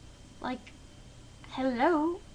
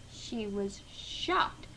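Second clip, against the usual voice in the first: usual speaking voice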